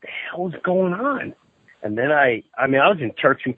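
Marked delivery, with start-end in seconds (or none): 0.0-1.3 hushed, whispery bewilderment; baffled disbelief. Retold or imitated. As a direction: retell being stunned, half under your breath. Retells his baffled reaction.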